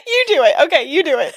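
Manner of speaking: usual speaking voice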